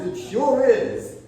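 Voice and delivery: savoring it, drawn out high; delighted BB. Retold or imitated. BB relishing the demo song, delighted and slow.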